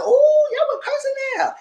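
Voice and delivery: high, slow put-on exclaim; mock gasp. Recalling her own shocked reaction to the cursing, high and slow.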